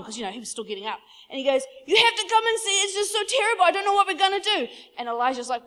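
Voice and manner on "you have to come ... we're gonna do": hammed-up panic, high and loud; freaking out. Servant urging his sleepy master to come out, panicked. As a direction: plead frantically in a raised voice. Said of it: "freaks out, comes running back in".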